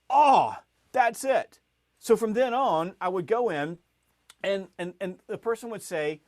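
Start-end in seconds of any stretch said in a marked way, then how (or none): 0.1-1.6 loud, high, drawn-out "oh"; big eureka moment, pitched up; sudden realization. Retold or imitated. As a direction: burst out slowly as the idea hits.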